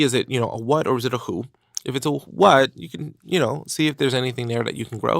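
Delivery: ordinary delivery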